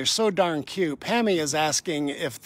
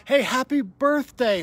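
bright, raised birthday call; excited greeting; 7 semitones higher, 4 dB louder than usual. Real, not acted. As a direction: call it out cheerfully. Calling out birthday shout-out.

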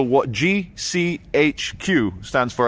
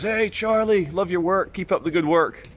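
bright, higher cheery shout-out from a passing cyclist; cyclist's greeting; 7 semitones higher than usual. Retold or imitated. Cyclist greets the vlogger, higher and cheery. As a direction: call it out like a friendly fan riding past. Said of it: "a guy on a bike goes".

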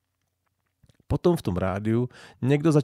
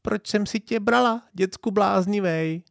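much higher squawking parrot imitation; 11 semitones higher than usual. A narrator's reading. Old parrot on the balcony repeating its question, high parrot squawk. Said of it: "papoušek vytrvale kradl ... osudovou otázku".

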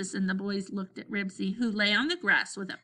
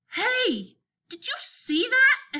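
high, loud, amazed yell; excited shout; 14 semitones higher, 5 dB louder, much slower than usual. A narrator's reading. Henry shouts about Ribsy guarding the bike, excited shout. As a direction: shout it out, pitched way up. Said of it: "Henry shouted".

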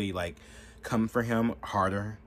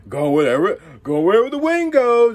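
high, loud mocking voice for a flip-flopper; hammed-up mock of a fickle politician; mocking Kamala; 12 semitones higher, 13 dB louder than usual. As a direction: sneer it out like a politician who follows the wind. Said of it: "She's only, like".

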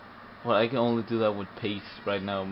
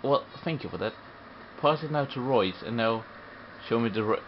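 ordinary talk, nothing marked

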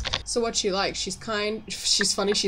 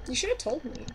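soft, higher-pitched reproach; gentle reproach; 6 semitones higher, 6 dB quieter than usual. Acted. Player tries out a reply to an old friend, softly reproachful. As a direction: say it small and high, holding back. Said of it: "that might be a bit aggressive".

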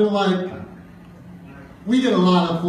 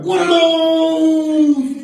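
very high, very slow, stretched-out mockery; drawn-out mocking cry; 13 semitones higher, 7 dB louder, much slower than usual. Friend roasting my shoes, a high stretched-out taunt. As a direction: drag it out like a meme taunt.

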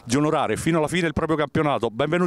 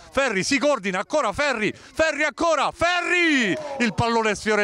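rising excited shout; 6 semitones higher than usual. Real, not acted. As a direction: pitch climbing to a yell on Ferri. Live near-goal call.

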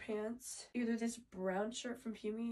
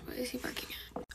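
whispering; 2 semitones higher, faster than usual. Real, not acted. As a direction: keep it all in a whisper. Whispers the whole video.

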